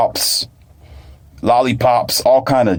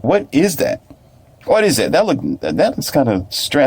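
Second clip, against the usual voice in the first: higher-pitched, puzzled questioning; imitated puzzled child; 6 semitones higher than usual. Child eyeing mentor's raw mushrooms, imitated puzzlement. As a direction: play a child wary of strange food.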